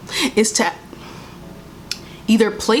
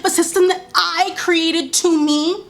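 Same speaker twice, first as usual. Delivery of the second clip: slower mock questioning, pitched up; mocking oppressor; 7 semitones higher than usual. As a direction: sneer it back at them. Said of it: "loves to ask".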